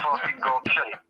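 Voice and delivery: "gobshite" said the Scottish way, low and rough with a hard k at the start; proper Scottish gobshite. Shown as a demonstration. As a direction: show him how a Scot says "gobshite". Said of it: "you can't say gobshite without the fucking accent".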